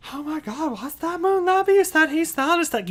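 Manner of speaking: high, loud squealing mock panic. Mocking panicked Twitter traders to viewers, high squealing alarm. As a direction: squeal like a hysterical crypto fan.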